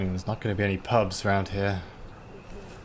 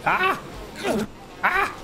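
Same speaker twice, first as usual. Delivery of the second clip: pained scream. Real, not acted. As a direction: shriek as the stings hit. Attacked by bugs, screaming.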